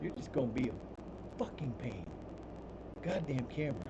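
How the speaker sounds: muttered curse. Real, not acted. Cursing at the camera.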